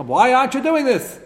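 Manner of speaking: high, loud rebuke; sharp demand. Retold or imitated. King rebuking the kohanim over the stalled repairs, sharp and loud. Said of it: "to talk like that".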